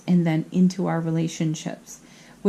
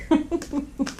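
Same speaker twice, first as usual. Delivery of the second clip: high giggle; 8 semitones higher, 3 dB quieter than usual. Real, not acted. Giggles after the joke.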